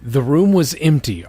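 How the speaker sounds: ordinary talk, nothing marked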